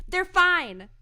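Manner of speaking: high, loud acted outburst; mock-defensive yelp; exasperated retort. Acted exasperated answer in a retold scene.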